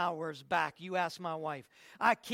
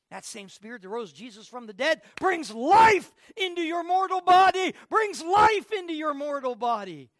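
fiery preaching; 6 semitones higher, 10 dB louder than usual. Acted. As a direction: preach it at full force. Preaching at full force.

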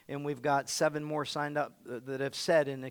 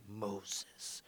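breathy whispered Moses; hushed reverent Moses; 8 semitones lower, 14 dB quieter than usual. Shown as a demonstration. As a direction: hush the name Moses. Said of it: "When I say Moses, it gets deep".

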